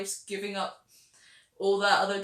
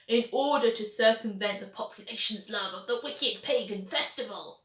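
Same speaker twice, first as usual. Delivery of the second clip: ordinary delivery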